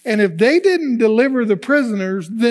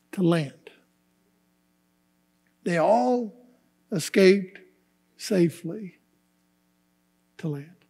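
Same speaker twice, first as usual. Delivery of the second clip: slowing into a soft, reverent hush; hushed emphasis; 4 semitones lower, 6 dB quieter than usual. Real, not acted. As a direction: let each phrase drop softer, with long pauses. Repeats verse slowly, dropping hushed.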